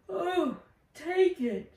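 yawned, high and slow; yawning. Acted. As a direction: stretch it out through a yawn.

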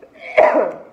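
harsh cough from burning spice; loud spicy coughing fit. Real, not acted. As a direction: cough hard, throat on fire. Spicy noodles make her choke.

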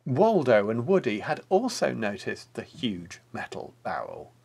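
plain reading voice

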